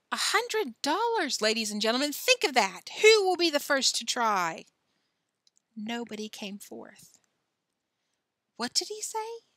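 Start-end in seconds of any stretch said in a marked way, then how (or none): 0.1-4.6 circus ringmaster. A narrator's reading. Ringmaster tempts crowd with prize. 8.6-9.4 put-on high-pitched child's question; puzzled little girl. A narrator's reading. Pippi asks, puzzled child's voice.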